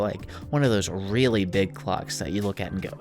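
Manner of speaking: ordinary talk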